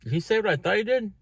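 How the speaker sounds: a quick, disbelieving mock Joe; imitating Joe's disbelief. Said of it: "Joe's like".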